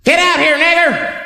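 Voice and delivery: high-pitched yell from outside; acted high holler at someone; mock hostile yell. Hooded man yelling at the caller, high mock shout.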